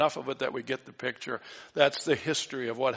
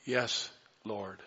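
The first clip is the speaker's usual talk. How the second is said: much softer, bowed and yielding; humble whisper-soft yes; 5 semitones lower, 8 dB quieter than usual. Acted. Woman answering Jesus, soft and humble. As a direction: answer softly, surrendering. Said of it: "But she replies".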